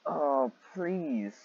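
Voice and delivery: ordinary talk, nothing marked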